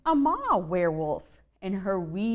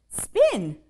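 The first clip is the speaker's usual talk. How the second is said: spider mom's cry; 5 semitones higher, 5 dB louder than usual. Acted. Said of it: "cried the mom".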